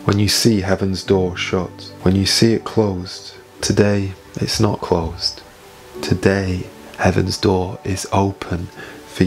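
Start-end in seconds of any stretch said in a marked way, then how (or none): none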